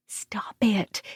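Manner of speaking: whispered, voiceless; mouthed. A narrator's reading. Gemma mouths back at Quinn, whispered. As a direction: hiss it silently back. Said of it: "Gemma mouthed back".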